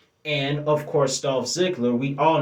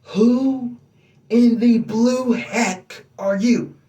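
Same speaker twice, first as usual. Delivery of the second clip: much higher, louder, slow mock challenge; The Rock's catchphrase; 9 semitones higher, 4 dB louder, slower than usual. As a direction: call it out like a cocky wrestler on the mic. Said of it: "The Rock's famous".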